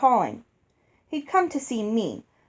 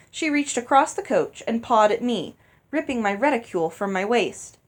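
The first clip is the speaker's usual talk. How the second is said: ordinary delivery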